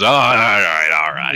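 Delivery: lightly voiced dwarf father, falling in pitch; a hint of a dad herding children; gathering the kids. GM voices Dwogal gathering his kids.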